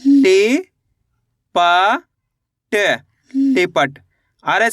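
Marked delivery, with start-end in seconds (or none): 0.0-3.0 लि raised and punchy, then प and ट each alone; अक्षर-अक्षर उच्चारण. Shown as a demonstration. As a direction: sound out लि, प, ट separately, long gaps between. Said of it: "एक बार फिर से देखिए". (लि, प, ट हर अक्षर अलग-अलग, रुककर बोलें)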